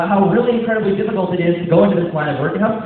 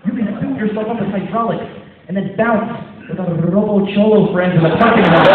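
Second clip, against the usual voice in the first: excited hype; 7 semitones higher, 6 dB louder than usual. Acted. Coach hype robot pitch.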